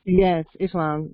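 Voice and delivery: ordinary talk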